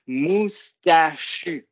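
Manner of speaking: moustachu, slow, one clap per syllable; syllables clapped out. Shown as a demonstration. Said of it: "Dites-le avec moi en tapant des mains". (moustachu lentement, syllabe par syllabe)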